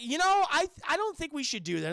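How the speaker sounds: high, hesitant mock worry; nervous objection. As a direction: balk in a high, uneasy imitation.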